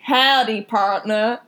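light Texan twang on "Howdy, partner"; mock Texan accent. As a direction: play a cheesy Texas cowboy. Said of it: "Texan accent".

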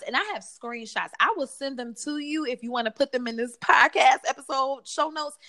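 ordinary talk

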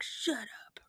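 unvoiced under-breath mutter. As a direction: mumble it so no one hears. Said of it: "mutter under his breath".

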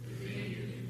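unvoiced whisper; whispered answer. Real, not acted. Pastor whispers the answer to the congregation, then repeats it. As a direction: breathe it out, barely audible.

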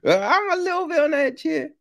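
high, fast, jittery mock fear; mock nervous. Horror fan acting spooked, high fast mock jitters. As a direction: rattle it off high like a spooked viewer.

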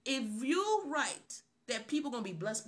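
usual speaking voice

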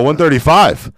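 high, loud shouted bid; shouting a bid. Retold or imitated. Outbidding the rival bidder, shouting the number. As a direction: yell the bid to win it.

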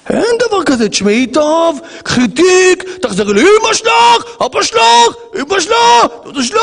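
high furious shout at the wife; angry husband. Pretend. Husband yells at his wife to go back to her mother, shouting. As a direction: explode and throw her out. Acted marital fight.

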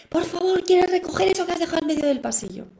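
mock yell, high and pushed; exasperated yell. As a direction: imitate snapping at the kid about the hallway. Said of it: "le debí pegar un grito".

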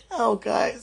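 choked-up cry of relief; tearful. Real, not acted. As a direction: near tears, overcome. Overwhelmed by emotion, crying.

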